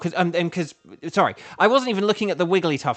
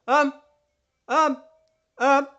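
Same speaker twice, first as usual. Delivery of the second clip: loud test call; 11 semitones higher, 6 dB louder, slower than usual. Shown as a demonstration. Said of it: "Like, if I go".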